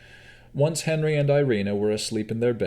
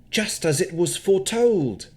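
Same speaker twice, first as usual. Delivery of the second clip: a lightly spooky fortune-teller line, higher; 11 semitones higher, 4 dB louder than usual. Acted. Gostomir showing Navara, a touch spooky. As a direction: intone it like a hint of a seer. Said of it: "Say it in a spooky way".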